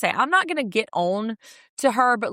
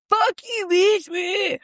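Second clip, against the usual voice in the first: mock drunk yell; 10 semitones higher, much slower than usual. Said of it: "Flashback to her".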